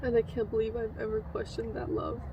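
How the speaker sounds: hushed, tender words, partly whispered; soft affirmations. Real, not acted. Alone after the haircut, softly thanking herself and God. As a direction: murmur love and thanks gently to yourself.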